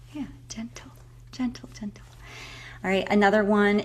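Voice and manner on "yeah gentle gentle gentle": soft soothing whisper. Shown as a demonstration. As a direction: coax gently under the breath. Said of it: "nice and soft".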